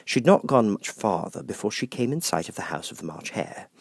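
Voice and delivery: usual speaking voice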